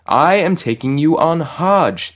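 high, loud, drawn-out announcement; joyful. A narrator's reading. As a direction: proclaim it with slow, ringing joy. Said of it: "the angel said joyfully".

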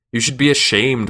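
screeching hag. A narrator's reading. Old hag scolds the man chasing a girl, screeching. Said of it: "one hag screeched".

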